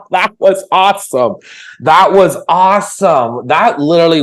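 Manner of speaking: high, loud, delighted praise; excited. Real, not acted. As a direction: gush with delight. Praising the impression.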